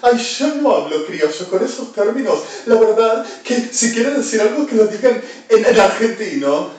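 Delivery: high, loud prissy mock quote; mocking whiny voice. Mocking a snob who dismisses criollo talk. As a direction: parody a snob refusing the slang. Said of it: "cuando se te habla en inglés".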